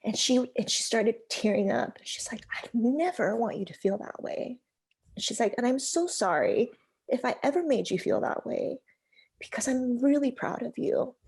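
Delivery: whispery, choked-up telling as tears come; real tears breaking into the words; fighting tears. Real, not acted. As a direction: near tears, voice barely holding. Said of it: "So I'm gonna cry".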